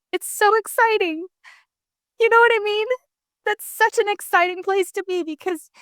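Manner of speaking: high-pitched, welling up with excitement; excited, near tears. Real, not acted. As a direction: let real emotion lift your voice high. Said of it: "I'm gonna start crying just thinking about it".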